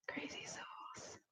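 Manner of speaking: slow, barely audible whisper. Real, not acted. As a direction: whisper it slowly, almost to yourself. Repeats the phrase in a whisper.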